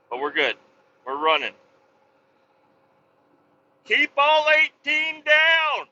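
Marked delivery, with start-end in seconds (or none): 3.8-5.9 slow, high and louder call-out; trucker sign-off shout. Real, not acted. Trucker signing off to viewers, slow loud call. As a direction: belt out the sign-off slowly.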